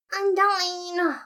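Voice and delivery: high drawn-out scolding call; high falling exclaim; scolding cry. A narrator's reading. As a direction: call her name in dismay. Alphys scolds her.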